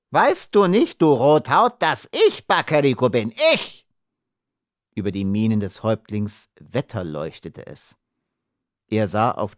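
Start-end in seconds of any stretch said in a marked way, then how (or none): 0.1-3.9 high, loud, offended protest; puffed-up indignation, starting high; indignant outburst. A narrator's reading. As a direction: demand recognition in outrage. Insulted little man protests.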